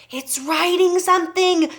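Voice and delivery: high, loud cry of alarm; screaming. A narrator's reading. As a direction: scream it like a startled schoolboy. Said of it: "screamed Nigel".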